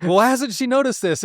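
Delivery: imitated exasperation, pitch jumping high; mock-frantic husband's complaint; going crazy. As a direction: act out someone losing it, high-pitched. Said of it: "he's, like, going crazy".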